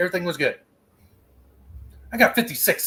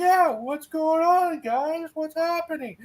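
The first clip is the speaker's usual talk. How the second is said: high, slow playing innocent; fake innocent voice; 12 semitones higher, slower than usual. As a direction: act clueless, high and drawn out. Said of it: "I'm like".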